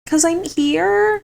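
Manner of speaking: high, slow, small voice; Helena's small answer. Retold or imitated. Helena answering Kane why he kissed her, high slow pitiful. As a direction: say it tiny and pitiful, dragging it out. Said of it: "And she's like".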